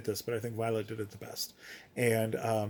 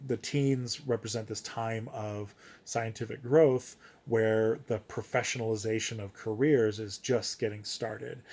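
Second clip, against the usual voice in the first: ordinary talk, nothing marked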